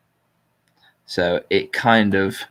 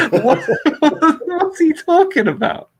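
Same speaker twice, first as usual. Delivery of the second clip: laughing; 4 dB louder than usual. Real, not acted. Breaks up laughing mid-reading.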